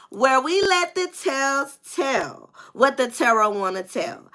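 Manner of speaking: slow branded intro, Tarot rising high; channel intro. Acted. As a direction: announce the show like a signature.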